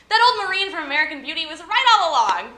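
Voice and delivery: loud, high, rising exclamation; exclaimed punchline. Acted. Host revealing the movie joke, loud comic exclamation. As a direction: cry it out as a big revelation. Mock-outraged punchline to crowd.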